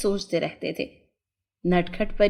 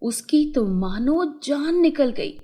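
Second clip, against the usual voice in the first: ordinary delivery, nothing marked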